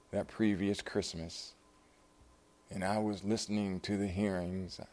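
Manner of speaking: soft, halting reminiscence in her voice; lightly imitated frail woman, hushed; hollow, fragile. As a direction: recall it quietly, pausing between phrases. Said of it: "sort of hollow, fragile".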